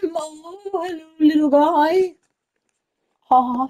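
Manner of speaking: high, overwhelmed cooing; gushing coo. Real, not acted. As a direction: melt over the lamb, voice going up. Cooing over a little lamb.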